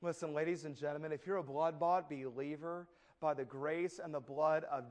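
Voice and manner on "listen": ordinary delivery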